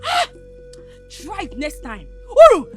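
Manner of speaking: furious tirade. Acted. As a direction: rage at her and threaten to break her head. Wife furiously scolding the intruder.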